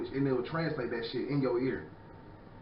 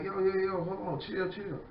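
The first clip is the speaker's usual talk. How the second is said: mock other guy; 7 semitones higher than usual. Other person voiced in story.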